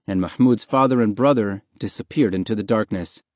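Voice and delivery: ordinary delivery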